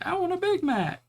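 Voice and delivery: put-on high-pitched little kid begging for a treat; squeaky kid asking for fast food; child's request. Acting out child Suzume's request.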